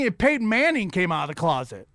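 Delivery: ordinary talk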